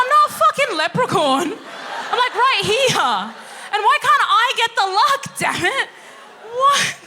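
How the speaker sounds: high, loud comic protest; hammed-up indignant yelling; indignant mock outburst. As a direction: shout it in exaggerated outrage. Acted indignant retort to Swiss family.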